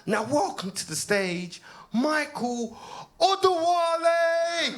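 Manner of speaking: hyping the crowd for the next act; MC announcement. Retold or imitated. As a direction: boom it out like a club MC. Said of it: "And the M C goes".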